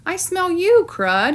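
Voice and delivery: puppy barking out a discovery; barking call. Acted. Dog under the sheet calls to his friend, barking. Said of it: "he barked".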